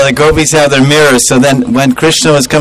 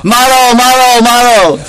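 high, raised war cry of kill, kill; mock battle yell; 8 semitones higher than usual. Gopis ambushing the cowherd boys, high rowdy yell. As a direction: chant the maro like a charging mob. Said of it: "they started yelling".

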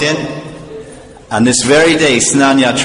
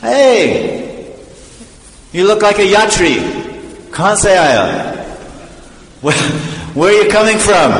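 high, cheery call to a passer-by; hailing a stranger; 7 semitones higher than usual. Acted. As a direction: call out in a raised, friendly pitch. Hailing a passing pilgrim.

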